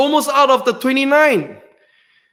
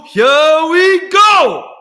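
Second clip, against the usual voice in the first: a slow, drawn-out, louder "here we go"; slow hype shout; 5 semitones higher, 5 dB louder, much slower than usual. Real, not acted. Host unveiling final race number two, drawn-out shout. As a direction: stretch it out like a ring announcer.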